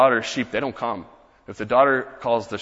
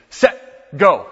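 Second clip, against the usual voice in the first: coach's call; 4 semitones higher, 7 dB louder than usual. Retold or imitated. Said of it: "as a football coach, he would say".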